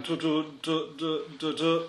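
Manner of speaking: broken, short "tu" syllables from a tongue-tied speaker; stuttering attempt. A narrator's reading. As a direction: stammer stuck on "Tu, tu", never reaching a word. Ferdinand tries to ask, stammers.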